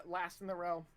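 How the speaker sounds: normal talking voice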